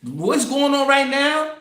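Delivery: normal talking voice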